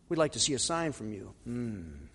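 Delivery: low, hushed, quick line closing in a hum; mock-pious request. Scribes asking Jesus for a sign, mock-concerned murmur. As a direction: murmur it piously, then hum with lips together. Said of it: "That's how these questions would always end".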